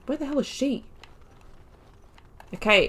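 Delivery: ordinary talk, nothing marked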